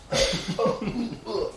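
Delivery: high-pitched mock grunting while dancing; imitated drunken grunts. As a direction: grunt like a clumsy drunk dancer.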